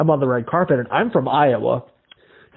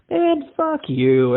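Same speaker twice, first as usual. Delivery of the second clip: high, very slow drawn-out jab; buddy teasing him over the lost audio, high and slow; mock disgust; 14 semitones higher, much slower than usual. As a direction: stretch out the insult.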